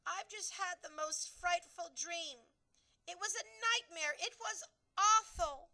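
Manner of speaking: a queen reliving a horrid dream; distressed Queen. A narrator's reading. Queen telling her maid the nightmare, distressed.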